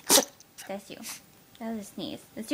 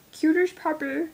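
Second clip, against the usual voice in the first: higher, louder, silly pet-talk voice; funny doting voice; 5 semitones higher, 6 dB louder than usual. Real, not acted. Owner gushing over her puppy, in a funny higher voice. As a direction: gush in an odd put-on voice. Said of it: "What was that voice?".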